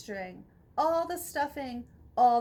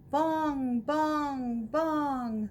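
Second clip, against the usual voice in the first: bell bong; much slower than usual. A narrator's reading. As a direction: toll each bong like a church bell. Bell rings under nest.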